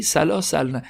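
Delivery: usual speaking voice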